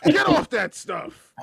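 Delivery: drawn-out mock TV dad; goofy clueless sitcom voice. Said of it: "sounded like a sitcom dad".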